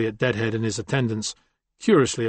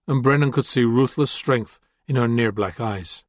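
usual speaking voice

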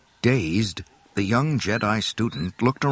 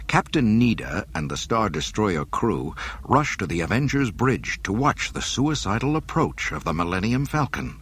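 ordinary delivery

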